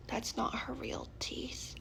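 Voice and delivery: secretive unvoiced whisper. [name] whispering a secret about Rebecca's teeth. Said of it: "whisper measured".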